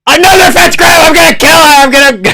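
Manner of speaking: high, loud real outburst; outraged screaming. Real, not acted. Fan losing it over a fence grab, outraged scream.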